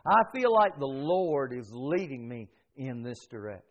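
normal talking voice

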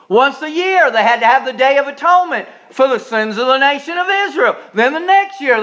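high, loud, driving sermon delivery; full-force preaching. Acted. Preacher pressing the point on the congregation, loud and driving. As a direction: preach it hard and fast to the congregation.

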